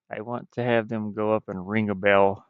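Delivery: usual speaking voice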